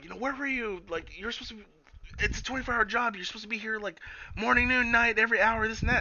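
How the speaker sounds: high whiny scolding; high mock voice. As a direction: nag in a squeaky voice. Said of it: "she asked her".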